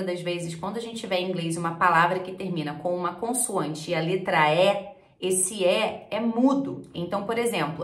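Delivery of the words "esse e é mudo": ordinary delivery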